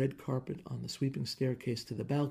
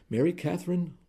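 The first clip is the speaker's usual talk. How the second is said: stern raised warning; husband warning his wife as he skids to a stop; 6 semitones higher, 5 dB louder than usual. Acted. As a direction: warn her by name, a bit louder. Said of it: "Rooney warned".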